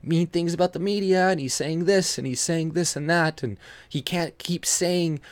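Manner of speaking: high mocking whine; mock media. Vlogger mocking the media blaming Trump, in a high mock whine. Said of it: "wanna use this to be like".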